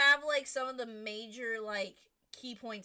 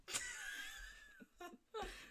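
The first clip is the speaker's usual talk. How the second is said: high squealing laugh; 8 dB quieter than usual. Real, not acted. Laughs after singing.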